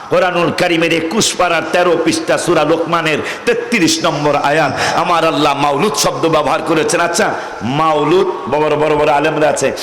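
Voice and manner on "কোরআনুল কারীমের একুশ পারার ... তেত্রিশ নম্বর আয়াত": ordinary talk, nothing marked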